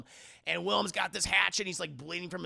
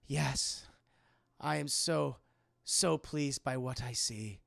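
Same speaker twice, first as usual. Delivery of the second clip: lightly hushed, lower reply; entranced Catherine; 4 semitones lower, 4 dB quieter, slower than usual. Retold or imitated. Catherine answers the unseen voice, softly entranced. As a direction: answer as if entranced, in short pieces.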